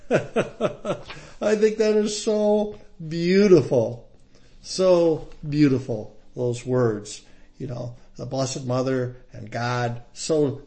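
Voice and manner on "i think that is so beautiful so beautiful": laughing delight. Real, not acted. Preacher delighted by Mary's words.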